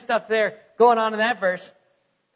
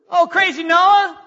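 high, loud scoffing jeer at Noah; mocking neighbor; 6 semitones higher, 7 dB louder than usual. Neighbor ridiculing Noah, loud high mocking jeer. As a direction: sneer it like a townsman mocking him. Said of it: "Be mocked".

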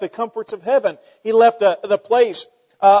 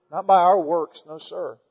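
ordinary talk, nothing marked

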